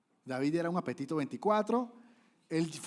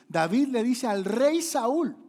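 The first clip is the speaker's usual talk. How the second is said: slower, louder, much higher; slow climax; 8 semitones higher, 9 dB louder, much slower than usual. Acted. Preacher peaking the suspense to the congregation, slow and loud. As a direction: peak the build on each word.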